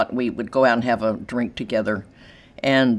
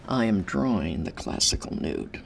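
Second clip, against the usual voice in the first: whispered aside; 4 semitones lower, 6 dB quieter than usual. Real, not acted. Contrast with abstract trend.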